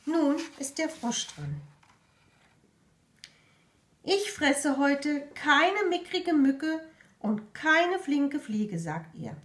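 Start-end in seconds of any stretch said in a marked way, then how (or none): 4.0-8.8 high, loud and slow bragging frog; boastful frog. Acted. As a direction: drag out the boast proudly.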